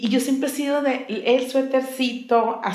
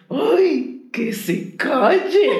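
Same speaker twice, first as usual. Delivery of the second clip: annoyed inner thought; 4 semitones higher, 4 dB louder, much slower than usual. Retold or imitated. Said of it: "Y yo me cachaba enojada".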